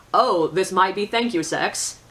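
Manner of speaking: usual speaking voice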